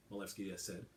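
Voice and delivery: usual speaking voice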